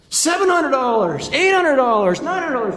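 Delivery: loud, high-pitched bidding shouts; auctioneer. Acted. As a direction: shout each rising bid to the crowd. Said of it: "the auctioneer starts things off".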